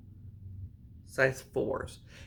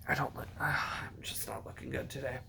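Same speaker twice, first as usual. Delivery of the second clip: soft unvoiced whisper; 6 dB quieter, faster than usual. Real, not acted. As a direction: whisper it like a confession. Self-deprecating aside about appearance.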